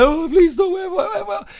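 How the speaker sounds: high-pitched pretend wailing; mock crying plea. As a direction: beg like a crying child, hammed-up. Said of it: "I'm crying, like".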